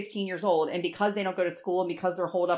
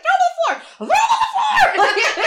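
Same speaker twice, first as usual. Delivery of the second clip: much higher, louder yell, cracking with laughter; mother yelling back at the tantrum, laughing, far higher; laughing shout; 12 dB louder than usual. Retold or imitated.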